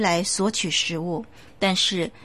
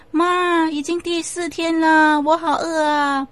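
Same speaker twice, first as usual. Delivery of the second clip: put-on high, tearful child wail of hunger; crying child ghost; 8 semitones higher, 4 dB louder than usual. The ghost child cries to his mother at midnight, high and tearful.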